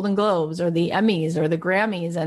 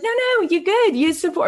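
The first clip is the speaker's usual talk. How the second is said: Susie brushing it off; 11 semitones higher than usual. Retold or imitated. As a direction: wave off the offer lightly and sweetly. Friend Susie brushing off help.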